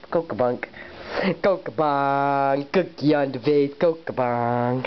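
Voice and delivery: low goofy mumble; low silly voice. Pretend.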